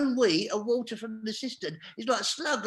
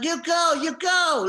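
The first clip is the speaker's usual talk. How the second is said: loud, high imitation of an angry cleaner; hammered-out mock yell, higher and louder; Mexican woman protesting; 8 semitones higher, 8 dB louder, slower than usual. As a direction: mimic her yelling through the door. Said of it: "She's going".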